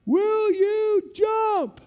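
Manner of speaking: very slow, high, loud question; sergeant's shouted question. Retold or imitated. Sergeant asking lieutenant if he will jump. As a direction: draw it out over the wind.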